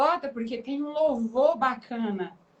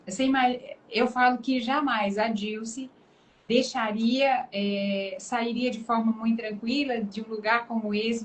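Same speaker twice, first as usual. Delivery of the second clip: ordinary talk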